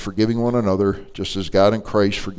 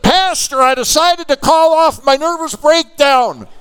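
very high, loud, drawn-out proclamation; triumphant shout; 7 dB louder, slower than usual. Retold or imitated. Lady tells pastor breakdown is off, jubilant shouting. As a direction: belt out the good news jubilantly. Lady announces her happy change.

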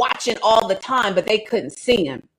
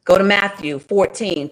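normal talking voice

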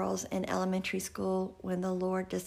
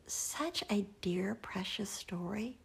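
slow, soft and tender; a slow tender whisper about a dear story; tender hush; 5 dB quieter, slower than usual. Real, not acted. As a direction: linger on it softly, moved.

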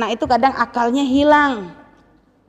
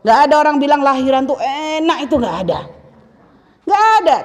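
usual speaking voice

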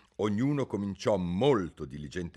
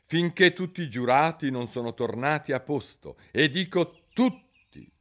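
insistent, pressing on tutti; stern king stressing every juror; 8 semitones higher, 7 dB louder than usual. A narrator's reading. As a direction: lay down the law to Alice. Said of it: "sottolineò guardando severamente Alice".

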